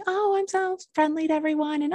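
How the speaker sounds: high, sugary mock-nice voice; fake friendly. At meetings, mocking her fake friendliness. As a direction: parody your own put-on friendliness. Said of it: "and I would be like".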